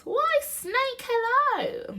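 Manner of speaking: very high, loud piping greeting; breezy mouse. Acted. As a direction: greet an acquaintance in a tiny mouse voice.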